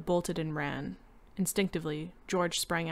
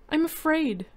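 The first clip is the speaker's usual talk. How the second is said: higher, louder frightened plea; 10 semitones higher, 8 dB louder than usual. A narrator's reading. Wife clinging to her husband, higher and frightened. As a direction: tell him you're afraid, near tears. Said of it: "cried steadily".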